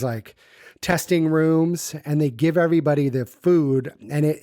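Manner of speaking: ordinary talk, nothing marked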